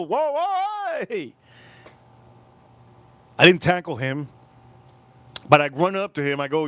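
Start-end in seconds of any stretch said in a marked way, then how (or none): 0.0-1.3 high-pitched retold call; stretched, high "whoa" as if across a lot; calling after someone. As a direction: re-enact calling out to stop a runaway. Shouting at friend running to car.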